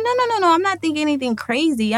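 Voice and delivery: high-pitched acted protest; quick protest. To the doctor offering options, high protest. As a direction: cut in high to rule it out.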